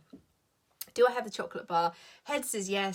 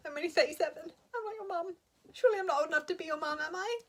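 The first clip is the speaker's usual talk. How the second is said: higher, faster mock indignation; mock offended protest; 10 semitones higher, faster than usual. Answering clients who call her Mama, high and mock offended. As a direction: play hurt at being called mum. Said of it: "And I'm like".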